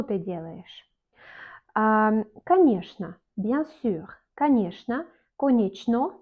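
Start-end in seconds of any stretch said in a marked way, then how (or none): 4.4-5.1 "Конечно" with sh, ka-NYESH-na; correct Russian pronunciation. Shown as a demonstration. Teacher giving the word for bien sûr, "Конечно" as kaneshna. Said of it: "On dit конечно". 5.4-6.1 "конечно" as written, ko-NYECH-no; spelling pronunciation. Shown as a demonstration. Teacher showing the written form, "конечно" as konechno. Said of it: "on dit pas конечно".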